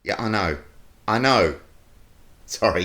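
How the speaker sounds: ordinary talk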